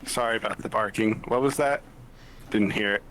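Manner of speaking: normal talking voice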